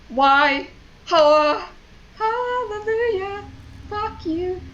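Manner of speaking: high, cracking-up outburst, climbing steeply; mental breakdown. Real, not acted. Player losing it over the game, mental breakdown. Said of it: "it's a mental breakdown".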